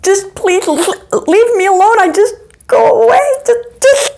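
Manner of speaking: high, rising, panicked begging; frightened pleading child. Retold or imitated. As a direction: plead to be left alone, near tears. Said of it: "I was like".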